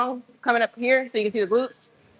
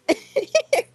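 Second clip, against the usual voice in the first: high laugh; 13 semitones higher than usual. Real, not acted. Laughing at critics.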